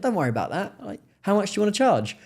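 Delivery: high-pitched, lightly put-on Cockney; Cockney Dave. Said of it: "I call him Cockney Dave".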